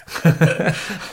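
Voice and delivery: airy burst of laughter; breathy laugh. Real, not acted. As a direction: laugh lightly through the breath. Laughs at his own onion joke.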